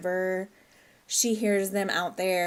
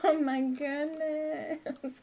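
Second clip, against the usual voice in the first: stretched out, high and slow, melting; melting aww; 7 semitones higher, much slower than usual. Real, not acted. Gushing to viewers over the toddler hugging his doll, slow and high.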